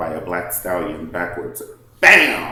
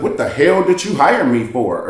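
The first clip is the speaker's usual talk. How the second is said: loud, higher indignant outburst; mock snap; 6 semitones higher, 9 dB louder than usual. Wedding planner snapping at Nene, loud and indignant. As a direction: fire it back at her.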